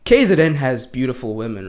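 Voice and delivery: ordinary delivery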